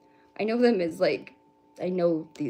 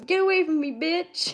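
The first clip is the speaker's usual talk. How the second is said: high, loud mock-tearful outburst; hammed-up tearful movie quote; mock crying; 10 semitones higher, 5 dB louder than usual. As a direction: imitate a crying man pushing someone away. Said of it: "he's crying, and he goes".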